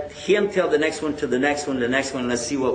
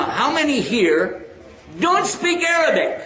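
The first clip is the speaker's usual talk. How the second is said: calling out; 9 semitones higher than usual. Real, not acted. Calling out to the audience.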